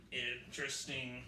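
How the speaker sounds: ordinary delivery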